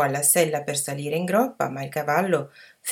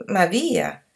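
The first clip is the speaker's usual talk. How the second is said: ordinary delivery, nothing marked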